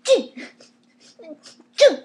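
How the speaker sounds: sharp loud hiccup bursts on "Choo!"; short explosive hiccups. Real, not acted. Caught by the hiccups in the middle of a voice lesson, loud hiccups. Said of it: "I've never heard you with the hiccups".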